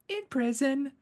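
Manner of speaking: put-on high, matter-of-fact little girl; tiny girl's voice way up high. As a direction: answer like an innocent small child. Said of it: "And he's like".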